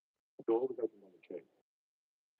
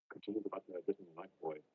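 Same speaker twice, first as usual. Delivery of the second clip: plain reading voice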